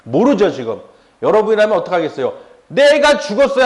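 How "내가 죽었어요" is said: high, loud mock lament; mock wail. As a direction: play a wife throwing a tantrum. Imagined wife wailing to husband. (남편에게 떼쓰는 아내의 높고 큰 하소연)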